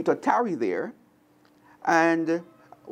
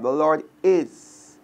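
slow meditative; much slower than usual. Shown as a demonstration. Teacher modelling meditation on the verse, slowly. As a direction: say "The Lord is" slowly and leave it open. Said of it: "As God speaks into your heart".

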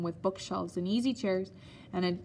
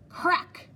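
a much higher, louder cane crack; sharp crack; 11 semitones higher, 5 dB louder than usual. Acted. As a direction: snap it out like a whip. Cane stroke sound imitated.

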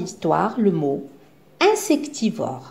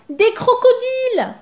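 much higher, alarmed cry; yelled warning; 13 semitones higher, 4 dB louder than usual. A narrator's reading. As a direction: warn them of danger. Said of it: "hurle Zigomar".

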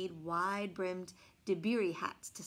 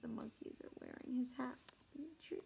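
soft unvoiced aside; hushed aside to listeners; whisper; 2 semitones higher, 13 dB quieter than usual. Real, not acted. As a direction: whisper while pointing at the picture. Aside while showing picture.

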